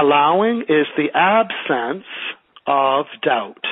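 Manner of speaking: slow, deliberate, each word spaced out; slow dictation. Shown as a demonstration. Teacher repeating the sentence for a student, slow dictation. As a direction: dictate it so the class can write it down. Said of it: "can you repeat that sentence again?".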